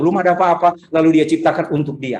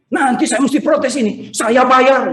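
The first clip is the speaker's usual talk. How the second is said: imitated high-pitched protest, louder at the end; angry grumbling; 10 semitones higher, 4 dB louder, slower than usual. Late-waking neighbour who missed the garbage truck, mock angry protest. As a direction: mimic a neighbour fuming with indignation. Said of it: "dia marah-marah".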